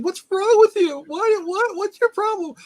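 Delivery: high, loud in-your-face demand; Adrian confronting Rocky. Retold or imitated. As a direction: shriek an impatient confrontation, very high pitched. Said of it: "Adrian's, like, in his face".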